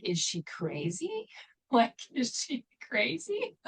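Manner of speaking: outsiders' drawn-out disbelief, played for laughs; mock incredulous. Outsiders reacting to her many jobs, mock incredulous. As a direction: imitate baffled onlookers, slowly, with a laugh.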